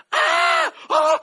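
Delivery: shrill startled cry of pain, very high and loud; sudden pained scream. Retold or imitated. Wife jolted awake by a punch, screaming in pain. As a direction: scream as if struck in your sleep. Wife hit in the mouth asleep.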